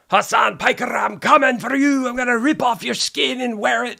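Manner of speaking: high, loud mock-Celtic threat; Sam Hyde. Retelling a fighter's victory threat, shouted Irish-turned-Scottish accent. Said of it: "supposed to be doing an Irish accent, but he's doing a Scottish one".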